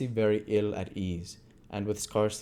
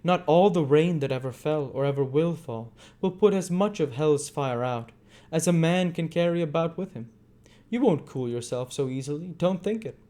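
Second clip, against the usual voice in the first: higher, louder grim voice; menacing Monks; 8 semitones higher, 4 dB louder than usual. A narrator's reading. Monks warning Bumble about hell's fire, raised grim voice. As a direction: threaten him with dark relish.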